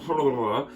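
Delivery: wordless mock tongue-tied noise; garbled tongue-tied babble. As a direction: blubber as if unable to talk properly. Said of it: "I can't even talk properly".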